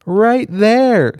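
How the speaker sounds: high, loud showy reply; loud pointing. Acted. Jack continuing the staged loud chat, loud and high. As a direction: play it up loudly.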